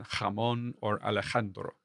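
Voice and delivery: "jamon" and "Alejandro" with a scraped Spanish j; Spanish-style throat fricative for j in "jamon" and "Alejandro"; Spanish velar J. Shown as a demonstration. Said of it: "many Spanish speakers pronounce the J".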